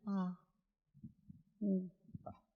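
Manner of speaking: soft, half-hearted little cries; feeble mock shout. Preacher showing the congregation how not to shout, feeble cries. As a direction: barely let the cry out. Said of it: "No diga".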